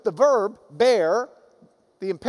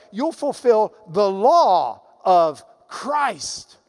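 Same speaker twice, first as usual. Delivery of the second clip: slow solemn emphasis; 4 dB louder, much slower than usual. Acted. Preacher drawing out the verse.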